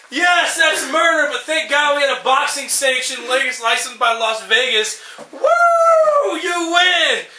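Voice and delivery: high, slow, laughing cheer rising to a whoop; hammed-up ringside celebration; mock crowd cheer.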